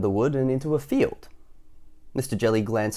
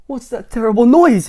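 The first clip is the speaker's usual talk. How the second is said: high, loud alarmed cry; panicked cry; 13 semitones higher, 12 dB louder than usual. Acted. Mister Jelly startled awake, crying out in panic. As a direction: wake up terrified by a noise. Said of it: "he cried".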